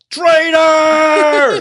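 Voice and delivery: high, drawn-out accusing shout; full-volume hammed-up denunciation; mock villain yell. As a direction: yell it out loud and high, stretching the word. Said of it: "come out and just yell".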